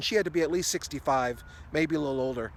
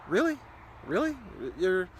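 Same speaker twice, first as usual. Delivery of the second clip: incredulous; 3 semitones higher than usual. Retold or imitated. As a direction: toss it back, unimpressed. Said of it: "And I'm going".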